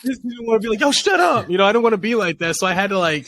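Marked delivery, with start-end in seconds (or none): 0.8-1.5 much higher-pitched mock outburst; quick, high, half-joking reprimand; mock snapping. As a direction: imitate an exasperated parent losing patience. Voicing the blunt version he avoided.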